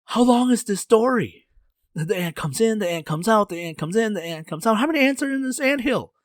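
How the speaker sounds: high, loud incredulous protest; exasperated outburst. Acted. King protests to Zha about the endless story, exasperated outburst.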